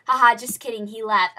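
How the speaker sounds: loud mock laugh and taunt; loud, jokey put-on teasing; teasing producer. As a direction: imitate a producer owning up to a prank. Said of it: "He goes".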